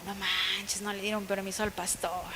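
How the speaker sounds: low, very soft mock disappointment; hushed dismay. Women hearing the pastor was refused permission, hushed dismay. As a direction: murmur it low, imitating a stunned group. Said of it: "Y entonces nos quedamos como".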